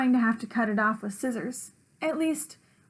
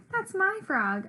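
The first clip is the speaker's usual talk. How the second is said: higher-pitched squabbling claim; insisting; 8 semitones higher than usual. A narrator's reading. Gobblecracker claims the frog from the narrator, insisting. Acted quarrel over the frog.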